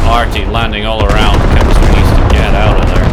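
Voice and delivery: loud, building play-by-play; excited commentary. Real, not acted. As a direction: ramp up the call as it lands. Caster builds with artillery hits.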